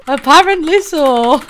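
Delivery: loud laughter through the words; laughing. Real, not acted. Laughing that Tiggs barely speaks, real laughter.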